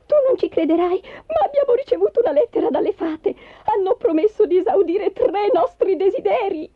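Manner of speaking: high-pitched, eager announcement; thrilled, high character voice; excited wife. Acted. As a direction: burst out with astonishing news. Wife announces fairies' letter excitedly.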